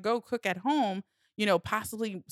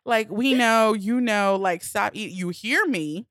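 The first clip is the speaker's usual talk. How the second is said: louder voice in your head demanding attention; insistent inner voice; 2 semitones higher, 6 dB louder, slower than usual. Retold or imitated. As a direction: nag yourself, pushing the volume up. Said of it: "it's the voice in your head".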